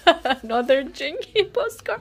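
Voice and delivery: high laugh running into the words; high-pitched, incredulous laughter; exasperated laughing. Real, not acted. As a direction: laugh helplessly through the line. Laughing at another duplicate.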